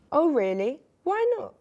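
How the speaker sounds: puzzled question leaping up high; high-pitched storybook bafflement; confused. A narrator's reading. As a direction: ask it baffled, ending very high. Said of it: "Confused was the wizard".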